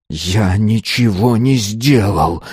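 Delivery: slow, tense growled denial. A narrator's reading. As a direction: growl each word through clenched teeth. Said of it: "прорычал Азар". (сдавленный рык, медленно)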